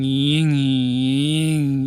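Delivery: imitating a surging engine; voiced engine sputter, ying ying; engine surging noise. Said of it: "the bike would be going".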